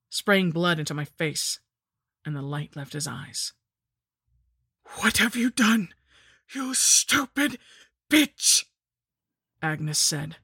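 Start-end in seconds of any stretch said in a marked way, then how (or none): 5.0-8.6 high, loud villainess outrage; furious lich. A narrator's reading. Lich seeing her ritual ruined, in a high furious cry. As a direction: drag out the insult, climbing higher.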